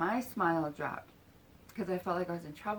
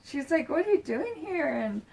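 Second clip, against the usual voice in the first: high, raised-voice imitated reunion squeal; excited greeting; 7 semitones higher, 6 dB louder than usual. Retelling the reunion on her lap, excited greeting. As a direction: burst out high, as if thrilled to meet. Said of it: "we're just excited".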